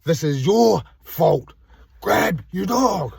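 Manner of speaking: a mild retold telling-off, drawn out; retold yelling. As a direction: lay the blame slowly, half-shouted. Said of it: "yelling at them".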